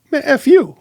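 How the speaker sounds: loud, very high mock outburst; imagined angry retort. Man wanting to snap at pal, mock furious. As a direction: burst out with the comeback you held back.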